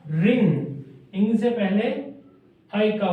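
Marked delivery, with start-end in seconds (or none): 0.0-1.1 ring stretched out very slowly; slow dictation. Shown as a demonstration. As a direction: draw out ring for students to write. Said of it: "ring. (slow repeat)". (Ring को बहुत धीरे खींचकर बोलें)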